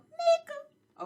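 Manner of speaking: high, loud showy name call; name announced. Acted. Host introducing herself to viewers, high and loud. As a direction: belt out her own name like a host intro.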